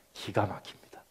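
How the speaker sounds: whispered in wonder; a whisper of amazement; awed whisper. Real, not acted. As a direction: breathe it out in awe.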